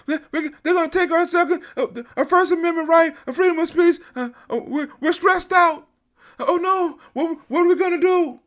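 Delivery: high, fast, whiny panic; hammed-up fretting about lost rights; high mocking voice of a panicked protester; mock panicked victim. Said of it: "we don't have that".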